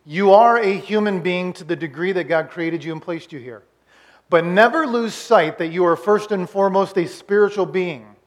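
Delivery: ordinary delivery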